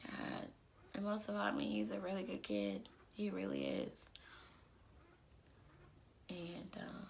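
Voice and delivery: quiet, choked-up voice after tears; after crying. Real, not acted. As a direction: pull yourself together after crying. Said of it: "sorry I got teary-eyed".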